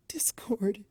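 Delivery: breathy high whisper of his name. A narrator's reading. Fluttershy whispering his name to Discord through tears. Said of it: "she whispered".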